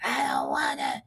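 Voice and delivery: slow, drawn-out raspy kid voice imitation; a slow mock rasp of the girl; mock raspy little girl. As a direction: ham up her husky little rasp. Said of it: "She done grew up with that little raspy voice".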